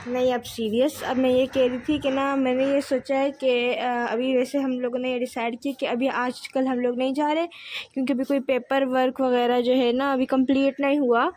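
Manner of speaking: ordinary delivery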